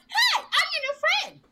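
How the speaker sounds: very high, loud and slow, gushing; overeager fan voice. Retold or imitated. Imitating Caroline introducing herself to Vivica in a very high, slow squeal. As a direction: squeal it out, eager to be friends. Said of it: "That's Caroline Rhea".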